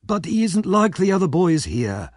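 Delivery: a hint of a wheedling old crook. A narrator's reading.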